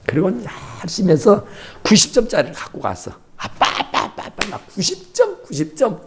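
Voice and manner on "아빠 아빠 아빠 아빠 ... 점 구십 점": put-on high, excited child calling daddy; a kid running to show daddy a score; eager child. Child showing daddy the test, calls out high and excited. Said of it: "이렇게".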